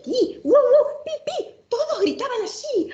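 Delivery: squeaky high chick and dog cries, slowed with a laugh; animal noises. Acted. Reading the rhyme, barnyard animals squealing high and giggly.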